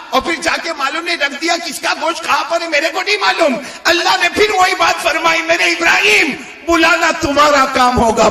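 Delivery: high, driving sermon delivery; high-pitched fervent retelling; impassioned preaching. Acted.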